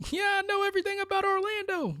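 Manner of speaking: high, quick squeaky boast; silly high-pitched imitation; mock know-it-all tourist. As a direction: mimic a clueless tourist up high. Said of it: "and being like".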